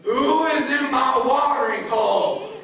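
high, loud, slow furious bellow with a laugh in it; angry crocodile shout. Acted. Crocodile shouting at the chicken in her watering hole, furious shout.

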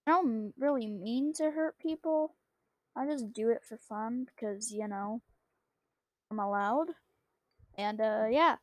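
ordinary delivery

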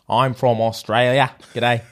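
broad comic Australian greeting; Australian accent. Shown as a demonstration. Attempting the accent a friend picked, Australian accent. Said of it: "Australia and say".

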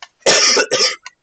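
harsh blast of a cough; loud cough. Real, not acted. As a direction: cough hard right into the mic. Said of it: "blasted the hell out of everyone's ears".

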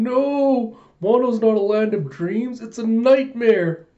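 high, loud, stretched-out wail; hammed-up despairing cry; mock anguish. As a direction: lament it like a battered cartoon victim. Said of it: "all just beaten up and bruised".

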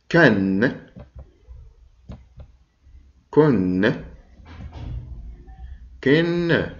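كن three times, rising high on the last; tanween endings. Shown as a demonstration. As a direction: model the nunation clearly. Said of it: "حرف الكاف مع التنوين هو كالتالي". (التنوين مع الكاف)

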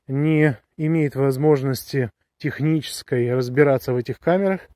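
usual speaking voice

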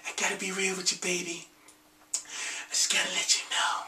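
hushed R&B seduction; sweet talk. Retold or imitated. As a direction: murmur it sweetly, fading to a whisper. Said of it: "talking all sweet and shit".

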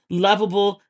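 normal talking voice

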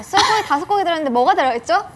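fast, loud sales pitch; home shopping host. Pretend. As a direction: rush through it like a TV seller. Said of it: "홈쇼핑처럼 막 해줘야 돼요". (빠르고 큰 홈쇼핑 쇼호스트 말투)

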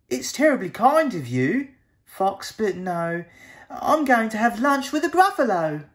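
high, polite and bright, a small mouse; little mouse voice. Acted. Mouse politely turns down the fox, high and bright. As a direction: chirp it like a clever little mouse.

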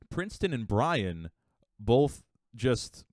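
usual speaking voice

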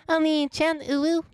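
hammed-up high cutesy Oni-chan; cutesy anime voice. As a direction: squeak it like a clingy anime sibling. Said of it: "I say it in a certain voice".

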